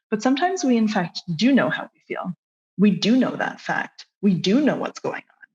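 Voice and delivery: ordinary talk, nothing marked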